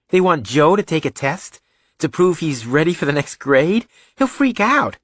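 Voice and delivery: put-on high-pitched, indignant young son; incredulous boy. Son protesting on his brother's behalf, incredulous. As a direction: protest in disbelief, voice climbing high.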